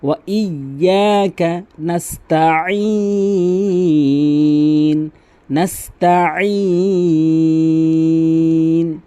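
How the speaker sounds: slow chanted নাস্তাইন with mid-throat ayn, ending held; pressed ayn. Shown as a demonstration. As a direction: press the ayn in নাস্তাইন and hold the last vowel. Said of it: "আইন কিন্তু চাপ দিয়ে বলবেন". (নাস্তাইন-এ আইন চাপ দিয়ে, টেনে)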